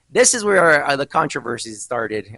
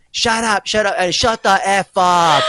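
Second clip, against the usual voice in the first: retold yelling; 5 semitones higher, 5 dB louder, slower than usual.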